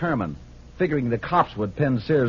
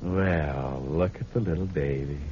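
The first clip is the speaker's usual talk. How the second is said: put-on tender baby talk; 7 semitones lower, slower than usual. Cooing over sleeping girlfriend.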